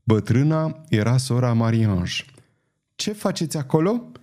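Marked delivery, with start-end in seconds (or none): 2.9-4.1 put-on high-pitched, suspicious old woman's challenge; lightly voiced cross old nun; scolding old woman. A narrator's reading. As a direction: question the intruders in a high voice. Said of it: "cu glasul răstit".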